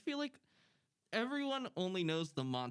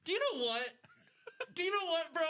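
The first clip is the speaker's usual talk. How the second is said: laughing; 13 semitones higher, faster than usual. Real, not acted. Laughing at stuck costume.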